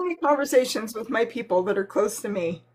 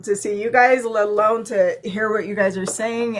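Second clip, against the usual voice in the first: usual speaking voice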